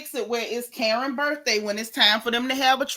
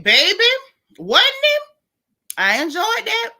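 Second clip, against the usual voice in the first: high, loud gleeful squeal; excited squeal; 12 semitones higher, 6 dB louder than usual. Real, not acted. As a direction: squeal it with delight.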